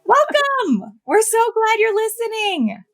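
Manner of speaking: high, bright radio host read; announcer voice. Shown as a demonstration. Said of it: "have an announcer voice".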